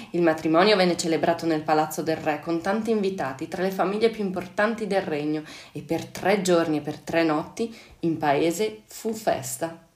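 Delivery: ordinary delivery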